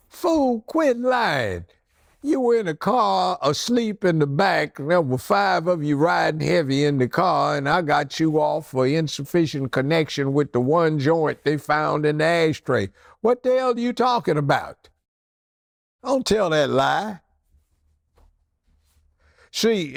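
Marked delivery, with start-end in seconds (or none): 0.1-4.0 high, incredulous call-out; mock outraged scolding; exasperated scolding. Judge scolds lying client. 13.2-17.2 high, incredulous call-out; mock outraged scolding; exasperated scolding. Scolding lying client.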